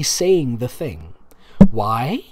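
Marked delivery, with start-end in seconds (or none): none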